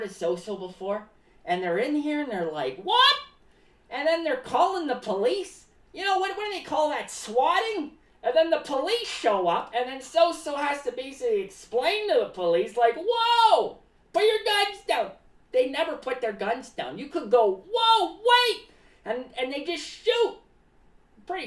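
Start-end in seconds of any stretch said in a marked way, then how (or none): 2.8-3.2 high, loud mock disbelief; acted startled outburst; shocked reaction. Imagined shocked newcomer to the show. 13.0-15.1 high, loud acted alarm; hammed-up urgent protest; mock alarmed plea. Acted plea to armed police. 17.7-18.7 high, loud acted alarm; hammed-up panic; mock panicked plea. Acted plea before being shot.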